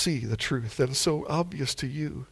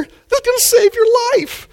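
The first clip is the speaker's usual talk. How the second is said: high, loud exasperated plea; emotional outburst; 7 dB louder than usual. Shown as a demonstration. Preacher exasperated at people fearing the MRI, high outburst. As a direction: burst out in disbelief, pitched way up. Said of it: "another emotional outburst".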